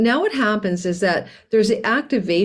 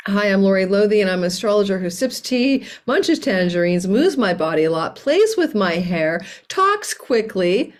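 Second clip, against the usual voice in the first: mock self-introduction. Astrologer lampooning YouTube critics' stage directions, mock self-introduction. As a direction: stretch each item as a tongue-in-cheek stage direction. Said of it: "These are all the stage directions and criticisms".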